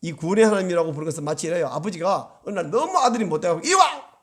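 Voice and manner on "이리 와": short, raised-pitch mock yell; father's angry call. Father calling his misbehaving son over, mock angry yell. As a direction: voice an angry dad summoning his boy.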